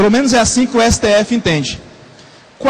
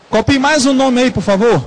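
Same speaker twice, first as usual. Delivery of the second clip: ordinary talk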